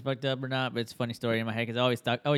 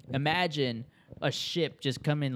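ordinary talk

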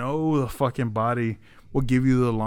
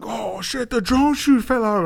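retold alarm; 9 semitones higher than usual.

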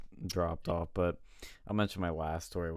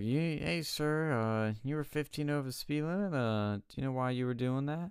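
mock police officer; 7 semitones higher than usual. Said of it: "She's like".

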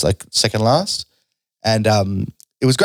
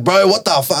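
angry stage yell; 8 semitones higher than usual. Retold or imitated. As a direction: punch it out like an angry comic. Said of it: "It was just like".

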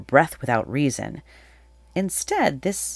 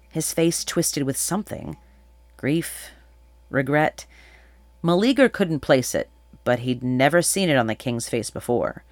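ordinary delivery, nothing marked

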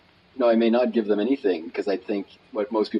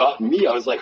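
ordinary talk, nothing marked

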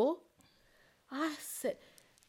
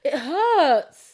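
mock pained whine; 7 dB louder than usual. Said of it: "be like".

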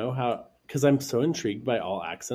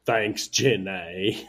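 very slow, loud Southern drawl; Forrest Gump; 5 dB louder, much slower than usual. Pretend. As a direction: drag it out like a simple Southern man. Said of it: "you sounded exactly like him".

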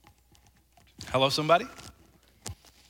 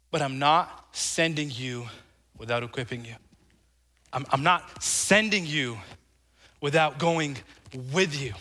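gentle reassurance; 7 semitones lower, 5 dB quieter than usual. Acted. Preacher voicing Jesus sending out disciples, gently reassuring. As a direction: lower the voice and reassure, unhurried.

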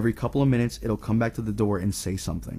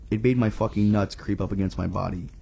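ordinary delivery, nothing marked

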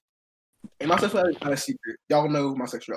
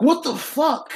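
shocked; 10 semitones higher, slower than usual. Retold or imitated. Shocked at girlfriend's text.

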